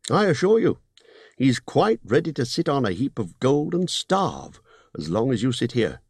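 高めの声で念を押すホビット; 利益の取り分を強調する商談口調; Bilbo's voice. Acted.

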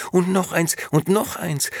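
high and loud, piling it on; eager excited. Acted. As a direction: keep tossing with gleeful eagerness. Tossing out more clothes.

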